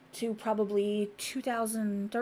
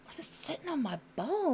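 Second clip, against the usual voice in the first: higher, whiny husband voice; 6 semitones higher, 4 dB quieter than usual. Retold or imitated. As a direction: whine it like a scared patient. Ex-husband quoted, retold.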